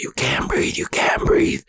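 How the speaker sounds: urgent voiceless whisper; whispered retort. Retold or imitated. Big brother answers the panicking kid, harsh whisper. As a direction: hiss it back at him in a whisper.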